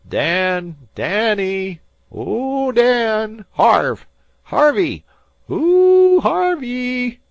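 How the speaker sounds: high, loud long hails; calling out. A narrator's reading. The cook searching the fog, loud calling out. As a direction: hail the boys across the fog.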